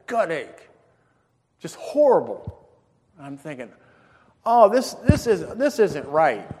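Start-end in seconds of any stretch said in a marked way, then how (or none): 4.5-6.5 high, loud stammered complaint; hammed-up discomfort; pained complaining. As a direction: protest through a bellyache. Complaining of stomach ache.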